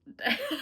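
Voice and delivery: a high embarrassed laugh through tears; high teary laugh of embarrassment. Real, not acted. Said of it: "I'm sorry to get emotional".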